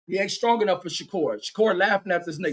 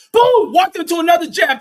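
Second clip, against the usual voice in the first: excited yell; 8 semitones higher, 9 dB louder than usual. Real, not acted. Commentator hyped over a clean jab, yelling high and loud. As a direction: Boom shouted very high and loud, then calling the jab at full volume. Calling a punch live.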